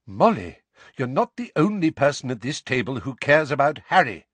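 sharp and firm rebuke. A narrator's reading. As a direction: cut in sharply. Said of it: "said Lupin sharply".

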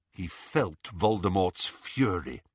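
plain reading voice

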